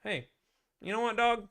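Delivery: high, lazy swagger of a salesman; lightly voiced smug dealer; cocky salesman. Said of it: "you could just be like".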